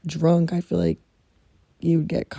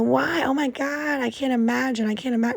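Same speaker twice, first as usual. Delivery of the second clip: mock shock; 6 semitones higher than usual. Mocking an acquaintance's shocked reaction.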